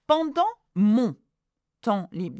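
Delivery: "Pendant mon temps" with nasal vowels, in separated chunks; teacher sounding out "Pendant mon temps libre" piece by piece. Shown as a demonstration. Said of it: "are all pronounced en".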